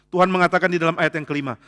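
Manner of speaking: ordinary delivery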